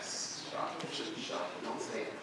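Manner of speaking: very soft, voiceless shushing; hushed reprimand. Retold or imitated. Sheikh silencing a man's bad word, hushed. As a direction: shut someone up under your breath.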